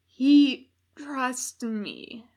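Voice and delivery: gasped defiance. A narrator's reading. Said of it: "Crowley gasped".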